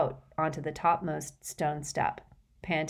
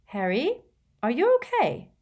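anxious; 8 semitones higher, 5 dB louder than usual. A narrator's reading. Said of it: "she added anxiously".

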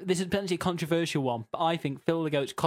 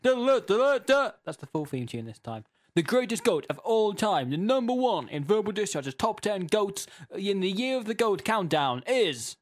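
announcer voice; 5 semitones higher, 3 dB louder than usual. Acted. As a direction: hype it like a game show host. Host builds to number one.

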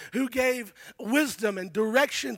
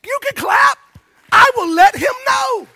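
much higher and louder, joking; playful call out; 9 semitones higher, 10 dB louder than usual. Real, not acted. Preacher urging the church to clap for their pastor, loud and playful. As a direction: call out to the crowd with a grin.

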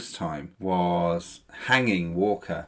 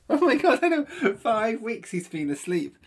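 mock shock; 11 semitones higher, 4 dB louder than usual. Mocking exaggerated shock.

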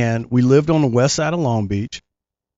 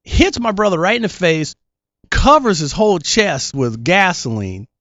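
ordinary talk, nothing marked

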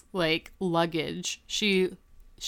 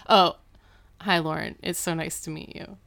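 chastened greeting. Retold or imitated. Dad after mom punches him, subdued chastened greeting. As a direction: imitate a dad meekly correcting himself.

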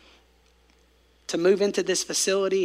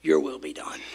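whispered surrender; 5 dB quieter than usual. Acted. Quietly quoting surrender.

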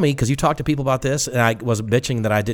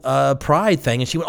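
ordinary talk

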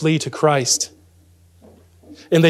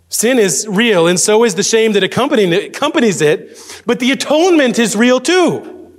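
raised, ringing pulpit voice; emphatic preaching; 7 semitones higher than usual. Real, not acted. Preacher affirming atonement to the congregation, emphatic preaching. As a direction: proclaim it high and forcefully.

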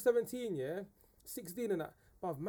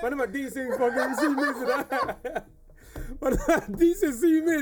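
laughing; 5 semitones higher, 8 dB louder, slower than usual. Real, not acted. Joking about pills, laughing.

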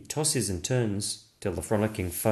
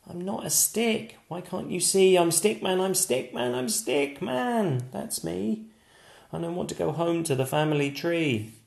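higher, earnest little stick figure voice; protesting Stickman; 8 semitones higher than usual. Acted. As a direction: insist on who you are. Stickman protests his name.

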